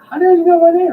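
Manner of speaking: high, loud amazed question; stunned surprise. Retold or imitated. Touring bassist amazed the store clerk knows him, high surprised squeak. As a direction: squeak it out, floored he's known. Musician stunned at being recognised.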